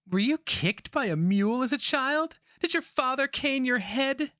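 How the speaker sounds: put-on high, scornful old witch; mock him from far above in a crone's voice; shrill old witch sneering at a captive; rat witch voice.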